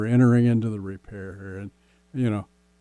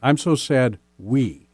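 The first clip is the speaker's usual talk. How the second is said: "we" held long and set apart; slow down and land on "we"; stress on we; slower than usual. Shown as a demonstration. Coach modelling the phrase for partners, stressing "we". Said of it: "framework of we".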